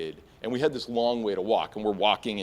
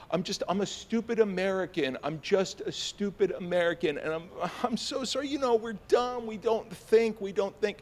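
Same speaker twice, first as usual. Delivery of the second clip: groveling apology; 8 semitones higher than usual. Retold or imitated. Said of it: "I'm going on like this".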